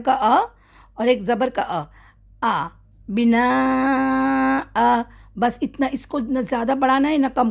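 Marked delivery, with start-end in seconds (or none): 2.4-5.0 بنا آ with a long drawn آ and no nun; pausal recitation. Shown as a demonstration. Teacher showing the pause on بنا آ. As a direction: stop on بنا and stretch آ for two counts. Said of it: "آ پورا پڑھیں گے".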